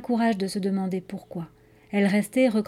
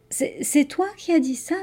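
stammered, higher-pitched question in disbelief; halting, higher stammer; 5 semitones higher than usual. A narrator's reading. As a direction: stumble over the first words, shaken by a talking crow. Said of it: "balbutia Anya".

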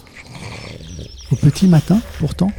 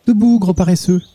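bright, much higher and happy; little girl waking her dog, joyful and higher; 8 semitones higher than usual. A narrator's reading. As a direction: wake him up cheerfully. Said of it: "lui dit-elle joyeusement".

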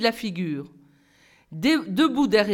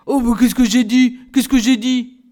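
louder, bewildered stammer; 2 semitones higher, 7 dB louder than usual. A narrator's reading. Lantier baffled by the class laughing, loud and flustered. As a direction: sputter in confusion. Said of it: "Lantier qui bredouillait".

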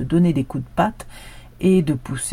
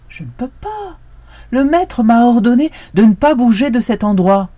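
protesting dog; 8 semitones higher, 9 dB louder than usual. A narrator's reading.

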